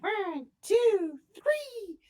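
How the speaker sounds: a tiny creature counting loudly, not hiding. Acted. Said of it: "the tiny voice whisper loudly".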